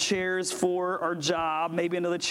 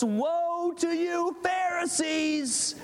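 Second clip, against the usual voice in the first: higher, slow ringing cry of Woe; denouncing shout; 11 semitones higher, slower than usual. Retold or imitated. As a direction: hurl it at them like a prophet's curse. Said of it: "launch into a string of insults".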